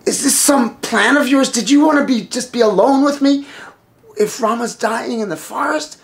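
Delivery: high-pitched indignant questioning; suspicious accusation. Acted. Wife suspects brother-in-law of a plot, accusing. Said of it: "her mind turns and she says".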